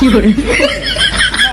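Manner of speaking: laughing. Acted. Comic skit, slow laughing reply.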